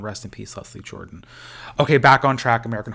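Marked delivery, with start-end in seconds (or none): 0.0-1.2 much softer, lower, fading tribute; somber farewell. Real, not acted. Host mourns Leslie Jordan, hushed and somber. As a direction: say goodbye gently to someone lost.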